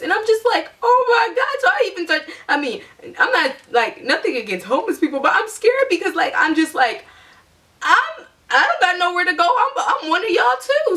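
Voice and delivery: high, lively scared telling with a laugh; high nervous laughing retelling; scared and amused. Real, not acted. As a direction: laugh through the fear, voice up high. Scared among homeless at night.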